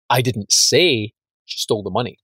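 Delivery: "say" stretched and punched, then the rest falls away; stress on SAY. Shown as a demonstration. Trainer demonstrating intonation to a course, stress on "say". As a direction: hit the word "say" and hold it. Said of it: "you emphasize one different word".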